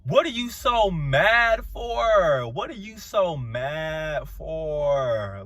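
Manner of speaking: slow, stretched-out disbelief; drawn-out, baffled scolding; incredulous drawl. Real, not acted. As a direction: drag out every word in exasperation. Incredulous repeated question to cast member.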